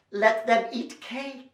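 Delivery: ordinary delivery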